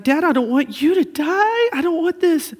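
high, loud imitated child's plea; upset little boy. Father imitating his son begging him not to die. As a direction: plead like a scared five-year-old.

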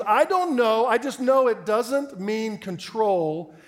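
loud, high, very drawn-out protest; stubborn outburst, stretching each word; emphatic insistence. Retold or imitated. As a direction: imitate an exasperated caller refusing to budge. Retold caller insists more forcefully.

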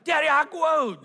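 put-on high, loud little-girl voice; excited toddler. Daughter pulling dad home to her growth board, high eager toddler voice. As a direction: squeal it like a kid who can't wait. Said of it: "she's like".

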